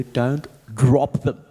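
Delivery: very slow, drawn-out don't drop them; weird negative tone. Retold or imitated. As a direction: stretch the warning in an odd tone. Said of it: "negative bits with a weird tone".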